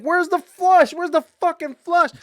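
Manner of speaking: high, loud panicked yelling; retold panic. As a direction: shout it like the boat is sinking. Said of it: "I'm panicking".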